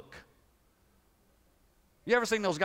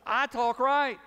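higher, louder mock-proud I talk right; smug retort; 6 semitones higher, 5 dB louder, slower than usual. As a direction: tease with playful pride. Playful retort about Southern accent.

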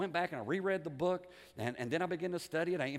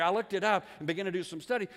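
ordinary delivery

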